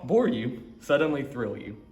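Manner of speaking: plain reading voice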